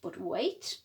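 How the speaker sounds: usual speaking voice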